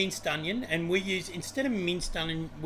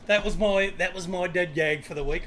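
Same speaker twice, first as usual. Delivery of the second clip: ordinary talk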